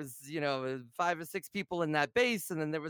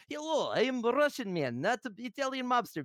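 a light mock Russian accent, higher; half-hearted Russian accent, playing a goofy spy; silly Russian spy; 5 semitones higher than usual. Streamer retelling walking up to the base, mild mock Russian accent. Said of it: "a silly spy thing".

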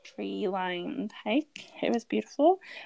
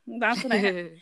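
usual speaking voice

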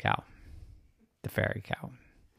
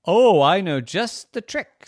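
loud, high eureka burst; high, loud excitement; excited fairy cow; 9 semitones higher, 12 dB louder than usual. Acted. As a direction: exclaim it with a sudden bright idea. Cow gets a sudden idea.

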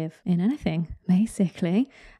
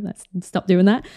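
ordinary talk

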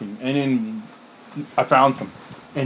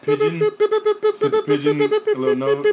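silly high voice. Pretend. Joking about pigeon messages.

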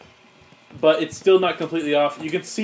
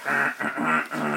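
jammed-gear noise; 2 semitones lower, 4 dB quieter than usual. Pretend. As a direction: grunt like gears choking on meat. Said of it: "gears getting stuck with meat".

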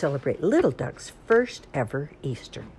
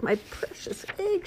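snuffling; 4 dB quieter than usual. Acted. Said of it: "she snuffled".

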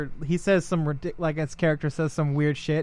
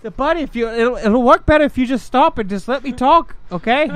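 put-on Canadian accent; 6 semitones higher, 3 dB louder than usual. Acted polite Canadian squabble.

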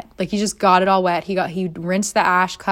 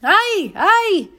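put-on much higher, embarrassed Mexican-accented apology; mock Mexican apology; 13 semitones higher, 5 dB louder, slower than usual. Guards apologizing to the naked man, Mexican accent. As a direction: squeal an embarrassed sorry. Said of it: "the guys are like".

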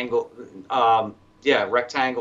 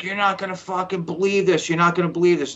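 higher, insistent mock disbelief; telling a buddy over the radio, excited and raised; excited urging; 7 semitones higher, faster than usual. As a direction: press him to believe you, pitched up. Retold urgent telling.

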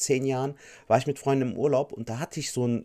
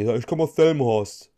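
mock proud local; 6 dB louder than usual. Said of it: "Dann sagen die".

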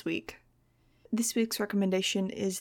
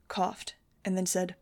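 ordinary delivery

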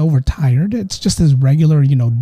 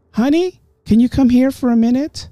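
calling out; 9 semitones higher than usual. Acted. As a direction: call sweetly from another room, pitched up. Unknown voice calling him like his wife.

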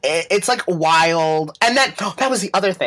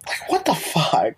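slow incredulous whisper. Real, not acted. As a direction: breathe it out in disbelief. Baffled aside about the movie.

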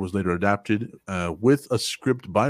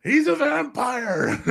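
mock outraged author; slower than usual. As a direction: whine it like the offended author.

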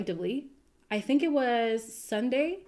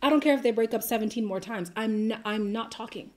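usual speaking voice

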